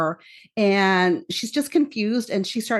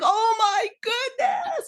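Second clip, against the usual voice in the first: retold excited scream; 12 semitones higher, much slower than usual. Viewer retelling her outburst at the TV, high mock scream. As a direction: squeal it slowly, way up high. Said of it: "I was screaming at the T V".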